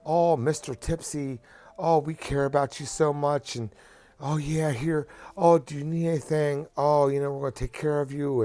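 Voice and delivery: high, syrupy mock caring voice; mock sweet nurse. Patient mocking the overly sweet nurses, high syrupy voice. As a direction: lay it on like fake hospital kindness.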